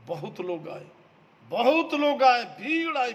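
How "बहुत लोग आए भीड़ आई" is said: louder, higher preaching cry; emphatic call. Real, not acted. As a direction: throw बहुत लोग आए out to the crowd. Repeat, much louder, higher. (बहुत लोग आए ऊँची, ज़ोरदार आवाज़ में)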